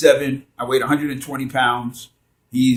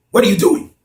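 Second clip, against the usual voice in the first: higher, louder sharp demand; sharp drill sergeant; 6 semitones higher, 6 dB louder than usual. Retold or imitated. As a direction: snap the question like a drill sergeant. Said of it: "he said in a deep, sharp voice".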